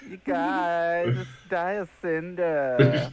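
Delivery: put-on high, drawn-out Valley Girl. Player imagines robot stuck as Valley Girl, hammed-up high drawl. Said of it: "only talks in Valley Girl voice now".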